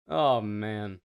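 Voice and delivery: usual speaking voice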